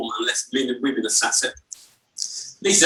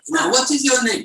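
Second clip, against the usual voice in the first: higher-pitched questioning rabbi; rabbi character; 7 semitones higher than usual. Acted. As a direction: quiz him as the Yiddish-accented rabbi. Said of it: "And he goes".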